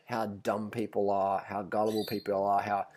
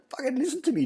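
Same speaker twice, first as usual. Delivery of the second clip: exasperated mock yell; faster than usual. Telling off the tourist.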